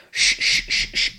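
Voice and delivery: whispered shh sounds like footsteps in leaves; imitated rustling. Describing the woods noise to a friend, whispered shh. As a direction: breathe out shushing noises like rustling. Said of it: "it was like".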